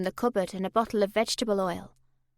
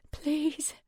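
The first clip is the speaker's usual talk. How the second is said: whispered plea; 6 semitones higher, 4 dB quieter than usual. Acted. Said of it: "low moaning sound ... turn into the words".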